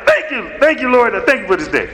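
hammed-up devout thank you; mock pious thanks. Mocking overly pious bedtime thanks.

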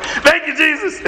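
hammed-up devout thank you; mock pious thanks. Mocking overly pious morning thanks.

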